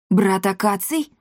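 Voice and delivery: taken-aback question; gasping surprise. A narrator's reading. Cat hears his brother's name, surprised. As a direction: echo the name in mild astonishment. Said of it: "ахнул Никодимус".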